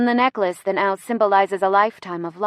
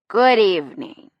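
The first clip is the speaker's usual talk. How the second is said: tipsy speechmaker, louder, words sliding; boozy public greeting; drunken slur; 5 dB louder than usual. A narrator's reading. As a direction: slur it into the microphone. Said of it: "He slurs into the mic".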